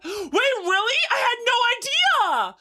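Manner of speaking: gasping, high and loud; shocked delighted gasp; surprised. Real, not acted. Gasp at news.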